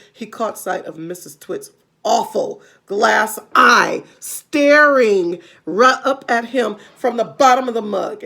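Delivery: plain reading voice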